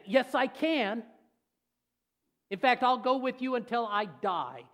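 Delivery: put-on high, loud childish insistence; stubborn kid answering back; a child refusing to take no; like a little kid. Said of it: "Just like a little kid".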